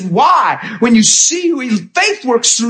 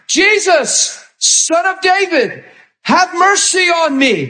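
higher, drawn-out plea; pleading beggar; 6 semitones higher than usual. Acted. Blind Bartimaeus begging Jesus, a lifted plea. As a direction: call out for mercy, voice lifted.

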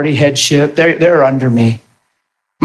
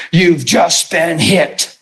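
slow ominous read; much slower than usual. Retold or imitated.

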